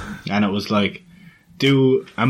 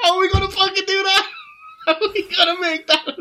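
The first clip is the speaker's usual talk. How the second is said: much higher, fast, laughing protest; laughing disbelief. Retold or imitated. Coworkers react to his quote, laughing high and fast. Retelling their exasperated reaction.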